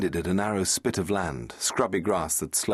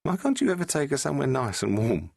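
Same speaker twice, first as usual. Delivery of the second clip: put-on higher, lively young woman; Rose's voice; 7 semitones higher than usual. Rose asking the Doctor about the sea, a young woman's voice.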